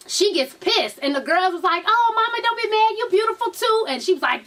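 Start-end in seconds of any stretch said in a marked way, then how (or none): 1.9-3.9 cajoling fans. Retold or imitated. Girls soothing [name]'s pissed girlfriend, high cajoling. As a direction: play fans sweet-talking someone, pitched way up.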